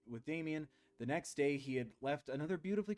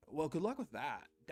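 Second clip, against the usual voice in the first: laid-back surfer voice; surfer dude. Shown as a demonstration. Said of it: "he just sounds like a, like a surfer".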